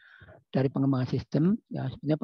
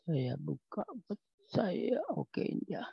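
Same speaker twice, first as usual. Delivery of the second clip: muttered whisper; 4 semitones higher, 11 dB quieter, slower than usual. Real, not acted. Muttering to himself while sharing screen.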